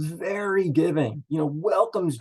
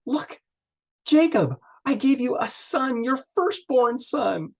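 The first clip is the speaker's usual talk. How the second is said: much higher, eager and proud; overjoyed Leah; 11 semitones higher than usual. Retold or imitated. Leah telling Jacob she bore him a son, high and overjoyed. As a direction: announce the firstborn son to your husband. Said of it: "Leah is so happy".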